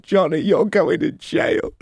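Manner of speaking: a hint of a scolding brother, slowed; imitating the brother. Vegas voicing his brother in the bath, mock tearful. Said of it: "my brother said".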